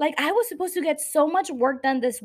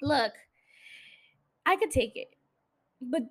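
ordinary delivery

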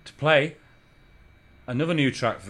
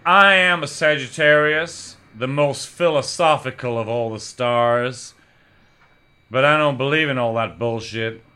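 loud, slow rock-poet declamation; mock American rock star pronouncement; 7 dB louder than usual. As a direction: draw the words out grandly and loudly. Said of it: "try to do a [name]".

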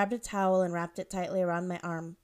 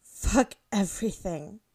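tearful, slow; 4 dB louder, slower than usual. A narrator's reading. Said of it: "I was crying".

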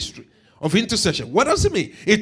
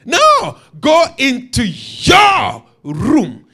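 slow right down and lean hard on "your room"; emphatic correction; much slower than usual. Retold or imitated. Preacher correcting his congregation on where to pray, emphatic.

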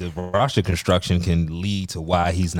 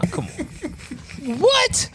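squealing laugh; much slower than usual. Real, not acted.

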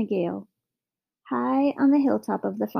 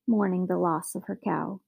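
usual speaking voice

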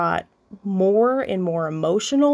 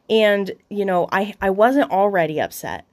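normal talking voice

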